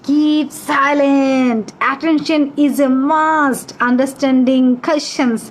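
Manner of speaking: very slow, drawn-out classroom rules; slow recitation. Shown as a demonstration. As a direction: recite each rule slowly for the class. (ধীরে ধীরে টেনে নিয়ম আবৃত্তি)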